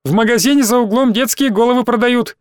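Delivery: high-pitched teasing call. A narrator's reading. Back-desk classmate baiting gullible Zhenya, calling out. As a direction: pitch it up to taunt across a room. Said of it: "крикнули с задней парты".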